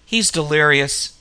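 ordinary delivery

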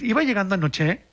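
"Iba llegando anoche" lifted like a question; statement with an unsure rise; question intonation. Shown as a demonstration. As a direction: end "anoche" with a rising tune. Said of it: "te lo hacen como pregunta".

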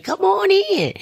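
very high, happy greeter's call; excited welcome. Retold or imitated. Usher greeting a newcomer at church door, excited. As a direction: sing out an imitated eager welcome. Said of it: "she was so excited and she was all happy".

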